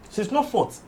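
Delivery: ordinary talk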